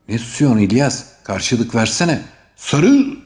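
high, loud, building to a yell; demanding shout. A narrator's reading. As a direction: push him, then yell the chant. Hamdi Bey demands a response. (yüksek sesle bağırarak üsteleyen)

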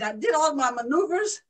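ordinary delivery

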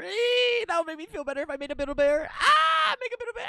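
high, loud excited squealing. Real, not acted. Streamer thrilled about Build a Bear, squealing. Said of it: "I sound like [name]".

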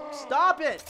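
high, loud protest; frustrated shout. Real, not acted. Gamer to blocking opponent, frustrated shout. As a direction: cry out at the opponent.

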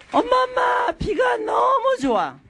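put-on high shouting child calling mom; excited child. Daughter calls to her mother about loving [name], high excited shout. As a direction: call out eagerly like a young teen.

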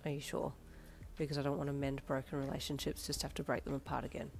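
dubious. A narrator's reading. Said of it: "Darby said dubiously".

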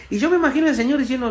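ordinary delivery

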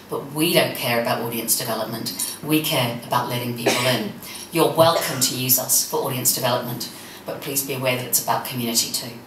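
low, worn-out hoarse voice; losing voice. Real, not acted. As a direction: voice going after talking all day. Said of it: "Sorry, I'm losing my voice after a lot of talking".

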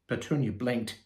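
ordinary delivery

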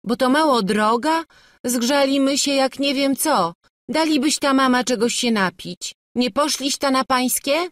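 high, loud village bickering; worked-up complaint; protesting. A narrator's reading. Tired couple and mother bicker.

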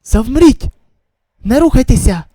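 loud sharp order to freeze; urgent loud command. Acted. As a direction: stop them dead with your voice. Commanding in danger. (гучний наказ)

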